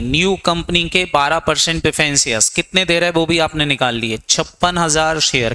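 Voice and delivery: ordinary talk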